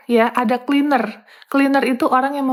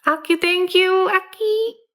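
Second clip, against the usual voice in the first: high, drawn-out call to a junior; sing-song summons from a fussy senior; a perfectionist senior calling her junior sharply; senior's demanding call; 6 semitones higher, slower than usual. Retold or imitated. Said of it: "seniornya tuh".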